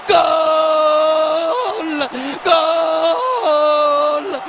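normal talking voice

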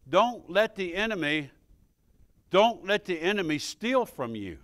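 normal talking voice

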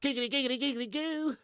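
high, rhythmic cartoon voice; cartoon catchphrase. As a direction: rattle it off in a silly high-pitched voice. Catchphrase impression after Family Guy talk.

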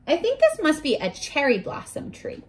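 usual speaking voice

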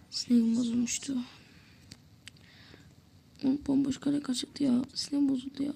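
muttering to viewers after a missed open goal; frustrated whisper. Real, not acted. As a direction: let the frustration out under your breath.

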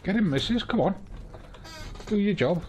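egging a companion on in a fight; cheering on. Real, not acted. Urging companion on during fight.

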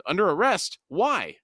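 surprised exclamation. A narrator's reading. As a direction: blurt it out in shock. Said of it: "Duiker exclaimed".

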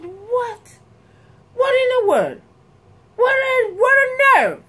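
high-pitched mock disbelief; retold astonishment in short high bursts; incredulous. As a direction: act out being scandalized, voice way up. Acting out disbelief at English replies.

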